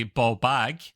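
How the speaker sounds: "baw bag" said the Glasgow way, broad Scottish vowel in "baw". Shown as a demonstration. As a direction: give "baw bag" its native Scottish sound, not English "ball". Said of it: "We'd actually say".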